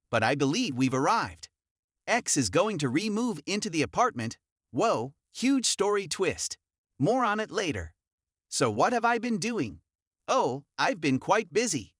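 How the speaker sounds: plain reading voice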